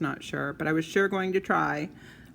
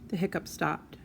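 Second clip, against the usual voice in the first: ordinary delivery, nothing marked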